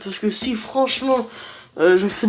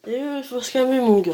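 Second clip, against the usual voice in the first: ordinary talk, nothing marked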